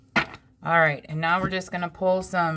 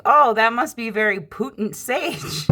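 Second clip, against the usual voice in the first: higher, louder, slowed joke delivery; joking punchline; 7 semitones higher, 5 dB louder, slower than usual. Retold or imitated. Telling sister the sage pun, slow playful punchline. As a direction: land the pun slow and loud. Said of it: "I'm like".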